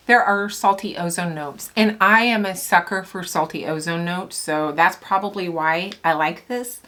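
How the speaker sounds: ordinary delivery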